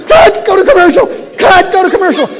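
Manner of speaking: loud, high yelled command; shouted order. Retold or imitated. Director calling for an emergency break, loud high shout. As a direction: yell it across the studio.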